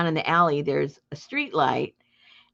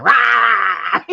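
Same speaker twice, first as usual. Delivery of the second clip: loud growling "Rah!" like an angry dog; imitated dog growl; 8 dB louder than usual. Retelling the dog lunging at Zippo, loud growl imitation. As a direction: bark it out as the snapping dog, loud. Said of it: "and Busta's going".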